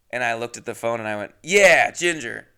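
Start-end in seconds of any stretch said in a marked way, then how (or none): 1.4-2.5 retold cover-up. Retelling cover for wrong name.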